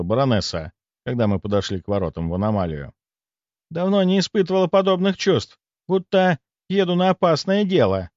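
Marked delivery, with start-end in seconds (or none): 3.7-8.1 higher voice of an excited baroness; взволнованно. A narrator's reading. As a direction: speak higher, thrilled by the adventure. Баронесса взволнована перед опасным делом. (взволнованно, повыше)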